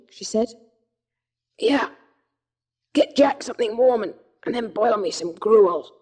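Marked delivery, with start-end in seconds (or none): 1.6-5.9 weak, hoarse half-whisper, short of breath. A narrator's reading. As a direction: rasp it out barely above a whisper, ill and exhausted. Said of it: "This was said in a hoarse whisper".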